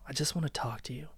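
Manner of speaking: hushed pleading captor; a sighing, whispered plea to his ex. Acted. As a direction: beg her softly to listen.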